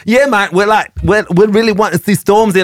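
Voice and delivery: high, bubbly mock posh girl voice; imitating posh girls. Festival girls telling the reporter they want [name], mock posh. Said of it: "really posh girls".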